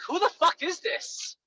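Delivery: high, slow, incredulous who the fuck; blunt call-out. Shown as a demonstration. Caller gets a blunt high demand. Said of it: "you should call them out right away".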